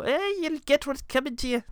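put-on high, creaky old man threat; lightly done old Irish man. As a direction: growl it like a cranky old man. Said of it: "a really old man kind of ... accent".

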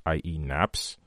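ordinary delivery

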